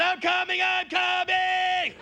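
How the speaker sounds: high, drawn-out climax scream; hammed-up screamer in bed. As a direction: scream it out, stretching the last word long. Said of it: "She's screaming".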